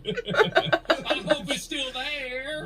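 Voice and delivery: high, slow yokel through laughter; mock country voice. Joining the Mark parody, slow laughing yokel. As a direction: drag it out laughing in a twang. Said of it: "that's how Mark actually talks".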